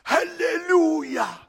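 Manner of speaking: loud, high, drawn-out hoarse cry; imitated hoarse voice. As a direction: mimic a friend's hoarse, slow shout of praise. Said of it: "he's got a hoarse voice".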